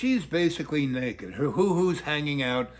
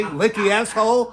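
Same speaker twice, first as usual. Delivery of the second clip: loud, higher teasing echo; teasing repeat; 6 semitones higher, 7 dB louder than usual. Real, not acted. Comedian teasing the child about her phrase, loud. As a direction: toss the phrase back playfully. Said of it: "What did you say?".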